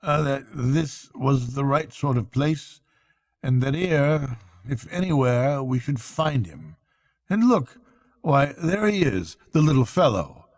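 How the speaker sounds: lightly voiced Rat, musing then brightening; a hint of a gentle water rat searching; understated Rat voice, spotting the little one; thoughtful Rat. Rat's reply in dialogue.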